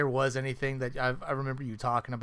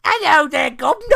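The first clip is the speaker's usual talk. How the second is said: high, loud mock Cockney greeting; bad British accent; 13 semitones higher, 14 dB louder than usual. Mocking the movie girls in a bad British accent. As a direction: ham up a terrible British accent. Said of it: "a really bad British accent".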